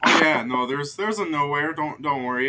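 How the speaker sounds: loud, bright-eyed "oh yeah, no"; smiling reassurance. Acted. Shifty guard Piff grinning as he brushes off Gick, loud. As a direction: brush him off with a big grin. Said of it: "his eyes kinda widen a bit as he smiles. He goes".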